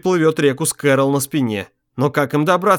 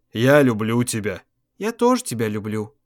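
tender; 4 semitones lower than usual. A narrator's reading. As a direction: say it gently, close. Tender love confession.

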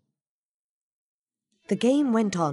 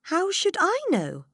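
higher, lightly voiced girl; Alice's voice; 14 semitones higher, 3 dB louder than usual. A narrator's reading. Alice answers the Queen, lightly voiced pert girl. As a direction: answer back like a young girl. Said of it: "said Alice".

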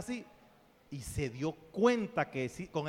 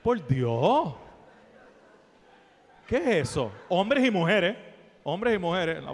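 loud, exasperated exclamations; comic outrage; 7 dB louder than usual. Acted. Speaker scolds the audience about tight leggings, loud comic outrage. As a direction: cry out in mock horror.

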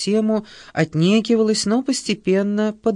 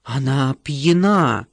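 slow, lower hiss; hissed accusation; 4 semitones lower, slower than usual. A narrator's reading. Sheba at the stewardess's back, hissing it slowly. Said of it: "прошипела Шеба в спину девушки".

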